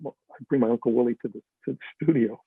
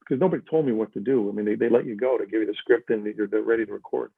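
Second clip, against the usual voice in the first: ordinary talk, nothing marked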